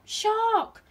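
high, loud storybook shout; shouted alarm. A narrator's reading. Naughty fish frightening the others, loud shout. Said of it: "shouting out".

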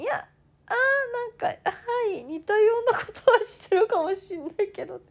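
a fluster of half-hearted excuses; flustered dodge. Retold or imitated. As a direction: fumble for an excuse. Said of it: "適当にごまかして".

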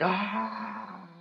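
hammed-up scream fading as he falls; mock falling scream. Soldier falling off the cliff, mock falling scream.

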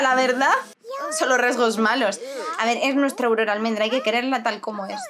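ordinary talk

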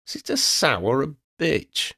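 high, pointed insult; cheeky girl. A narrator's reading. Girl insults dragon, high cheeky voice. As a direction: toss the insult innocently.

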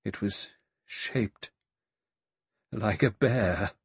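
slow quaver, broken by pauses; shaking, quavering. A narrator's reading. Vera repeats in horror, voice quavering. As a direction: repeat it haltingly, voice trembling. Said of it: "her voice shook and quavered".

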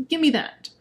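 ordinary talk

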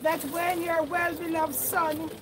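fervent prayer. Acted. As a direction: lift the prayer up with full voice. Prays aloud over the grave.

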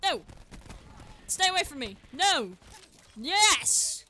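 loud, high frantic shouts; real panic in a gunfight; panicked yelling. Real, not acted. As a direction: scream at the enemy to back off. Panicked fight in game.

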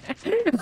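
high, helpless laughter; real amused laughing fit. Real, not acted. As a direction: crack up at a game mishap. Laughing at the mine trick.

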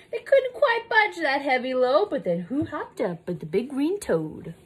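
bouncy, swooping rhymed read-aloud; sing-song storybook telling; picture-book story narration. A narrator's reading. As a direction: read with rising suspense for a small child. Reads picture book to child.

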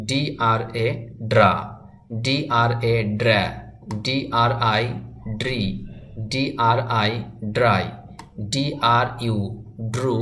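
ডিআরএ spelled out slowly, then ড্রা said as one blended syllable; slow spelled drill. Shown as a demonstration. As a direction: spell each letter, then snap the cluster together. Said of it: "দেখুন আমি কিভাবে করছি". (ধীরে বানান করে তারপর ড্রা একসাথে)

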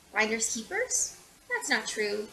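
ordinary delivery

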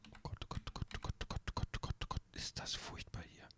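breathless frightened oh god whispers; whispered panic. Real, not acted. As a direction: whisper it terrified on the stairs. Panicking on stairs again.

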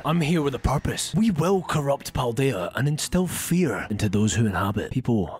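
deep, low villain declaration; menacing villain. Acted. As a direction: growl out a dark vow. Villain speech.

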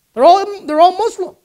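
high, loud imitation of sudden discovery; mock realisation. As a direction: act out the answer dawning on someone. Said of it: "you're all trying to think".

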